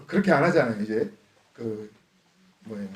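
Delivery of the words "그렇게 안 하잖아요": chuckling through the words, quick; laughing. Real, not acted. Preacher laughing at his own joke to the congregation, quick chuckle. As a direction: laugh it off, fast.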